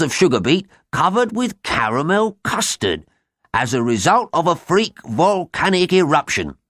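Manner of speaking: high, dramatic reading of a news item; excited news read-out. A narrator's reading. Brother reads the headline story to his sister, high and excited. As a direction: relish the shocking story, pitched high.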